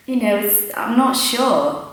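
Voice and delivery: unsure learner voice. Retold or imitated. Learner doubting she can follow British speech.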